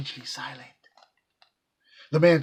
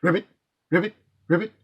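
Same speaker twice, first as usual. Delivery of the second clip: higher, chirpy ribbits; bright mock frog calls; frog croak; 5 semitones higher, 5 dB louder, much faster than usual. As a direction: pipe it like a little tree frog. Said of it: "a tree frog piped".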